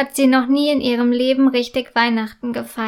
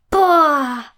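delighted exclamation; 3 semitones higher, 4 dB louder than usual. Acted. Girl sees presents appear by magic, thrilled loud wow. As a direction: burst out in amazement at the gifts. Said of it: "ruft diese voller Begeisterung".

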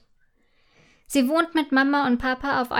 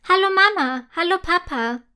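higher, louder excited greeting; calling out; 6 semitones higher, 3 dB louder than usual. A narrator's reading. Girl bursting in, calling to her parents. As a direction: call out running into the kitchen. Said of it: "ruft sie".

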